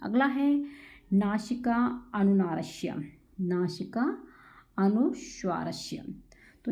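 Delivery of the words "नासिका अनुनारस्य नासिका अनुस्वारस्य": slow term recital. Shown as a demonstration. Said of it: "teacher spells out sutra slowly".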